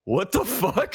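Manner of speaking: high-pitched, incredulous retold reaction; baffled amazement, pitch jumping right up; stunned disbelief. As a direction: blurt it out in mock astonishment.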